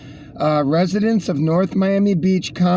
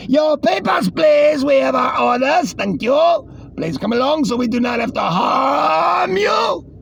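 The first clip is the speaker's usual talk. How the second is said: stern police officer; 8 semitones higher than usual. Acted. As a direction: order them like an authoritarian cop. Acted police officer order.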